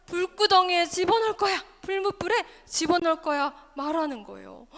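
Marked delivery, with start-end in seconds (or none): 0.1-3.5 loud storybook threat; threatening king. Acted. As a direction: threaten them as the king, loudly. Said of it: "왕의 협박을 받았네요". (큰 소리로 협박하는 왕처럼)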